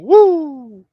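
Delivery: high, loud wrestler woo; Ric Flair woo. Retold or imitated. Host recalling Charlotte, loud high woo. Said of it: "involved going".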